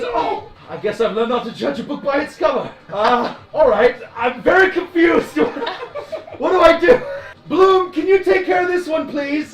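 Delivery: high and loud, cracking up through the lines; bewildered hero laughing as he calls for help; laughing in character. Acted. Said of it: "He goes".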